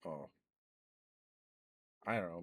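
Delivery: normal talking voice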